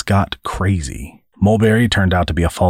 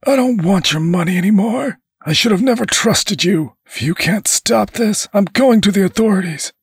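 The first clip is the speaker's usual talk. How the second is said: high, agitated tirade; angry man. A narrator's reading. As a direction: rage at her in a high, strained voice. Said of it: "trying to soothe the angry man".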